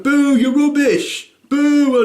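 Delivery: loud slow heckling; heckling shout. Retold or imitated. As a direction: boo like a rowdy fan.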